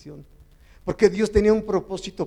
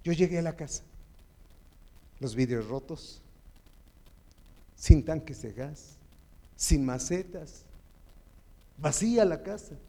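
ordinary delivery

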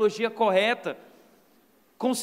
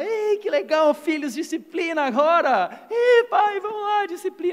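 much higher, louder mock glee; mock cheerful parents; 8 semitones higher, 6 dB louder than usual. Parents pretending discipline is fun, loud and gleeful. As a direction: ham up joyful parents. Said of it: "O pai e a mãe lá".

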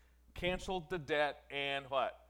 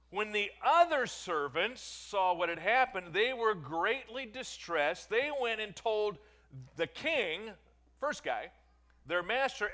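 lifted, louder Bible reading voice; raised scripture reading; 7 semitones higher, 7 dB louder than usual. Acted. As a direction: proclaim the parable to the congregation. Reads scripture aloud.

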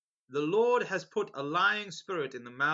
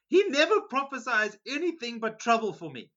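higher, louder complaint; louder exasperated king; 7 semitones higher, 6 dB louder than usual. Acted. King of Israel to Jehoshaphat, loud exasperated complaint. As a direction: throw up your hands and complain.

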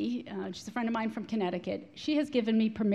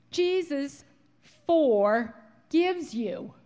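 very slow and drawn out, a little louder; slow skywriting words; 5 semitones higher, 4 dB louder, much slower than usual. Acted. Reading the next skywritten picture, very slow and stretched. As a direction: stretch every word like smoke letters.

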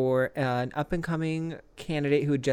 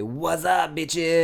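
raised, hammed-up catchphrase; catchphrase call; 3 semitones higher, 4 dB louder, much slower than usual. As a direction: toss it out as a mock signature line. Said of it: "what if my catchphrase is".

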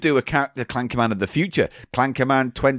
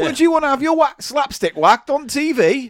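teasing high voice; 11 semitones higher than usual. Acted. Repeats question, teasing, much higher.

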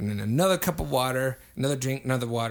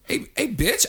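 much higher-pitched mock outrage; retold indignant callout; 11 semitones higher than usual. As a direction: call out the bartender indignantly, retelling it. Retold quarrel with bartender.

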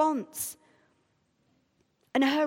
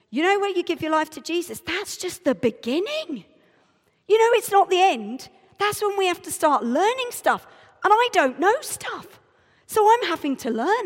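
high, loud, earnest lecturing; high-pitched; 6 semitones higher, 6 dB louder than usual. Acted. Explaining faith to a woman at the station, high-pitched and insistent. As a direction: keep it high and insistent, all worked up. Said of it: "I'm getting all high-pitched, just like I was then".

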